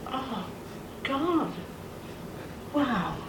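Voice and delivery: hushed, halting amazement; re-enacted quiet disbelief; stunned awe. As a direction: breathe out each word softly, with long pauses. Retelling stunned reaction to healed voice.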